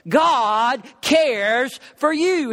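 each word of "God cares for you" drawn out slowly; the sermon's core line, slowed right down; emphatic slow message. Acted. Repeats key message.

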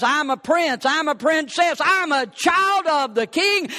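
ordinary talk

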